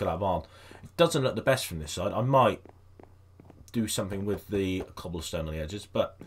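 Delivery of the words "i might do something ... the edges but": ordinary talk, nothing marked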